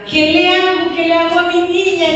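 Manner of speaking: high, pleading mother's cry; a desperate mother begging a neighbour for help; frantic mother. Retold or imitated. Mother begging the neighbour to save her child, high and pleading. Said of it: "Entonces dice mi mamá".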